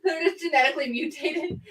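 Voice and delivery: talking through a laugh; giggling out the words. Real, not acted. Laughing at the snake joke.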